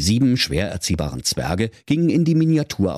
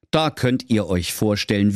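ordinary delivery

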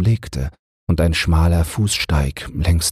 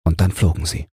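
plain reading voice

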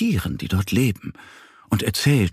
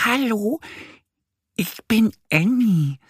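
much higher, gentle greeting; shy little duck. A narrator's reading. Little duck introducing herself to the stranger. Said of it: "sagt die kleine Ente".

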